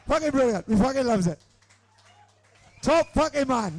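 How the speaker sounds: usual speaking voice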